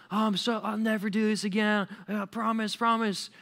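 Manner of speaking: high, whiny pretend wail; hammed-up blubbering promises; absurd mock crying. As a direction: plead tearfully like a caught kid. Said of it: "crying, like, absurdly".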